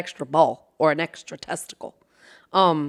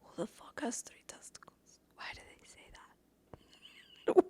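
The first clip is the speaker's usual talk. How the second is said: whispered disbelief; 21 dB quieter than usual. Real, not acted. Asking viewers why anyone says it, whispered.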